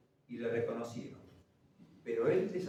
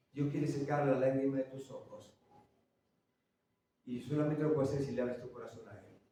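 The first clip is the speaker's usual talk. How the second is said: usual speaking voice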